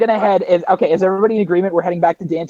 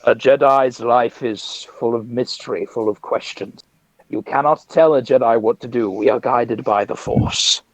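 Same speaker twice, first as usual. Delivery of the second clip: measured, lower-pitched voice of calm conviction; solemn Jedi; 6 semitones lower than usual. Acted. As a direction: intone it like a wise Jedi master. Plays a Jedi character.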